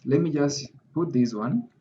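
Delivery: ordinary delivery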